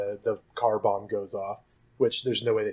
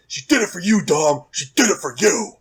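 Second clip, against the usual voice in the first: Brian's plea; 5 semitones higher, 5 dB louder than usual. Retold or imitated. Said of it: "And Brian is like".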